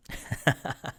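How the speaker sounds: short chuckle at his own bit; wordless giggle in quick bursts; laughing. Real, not acted.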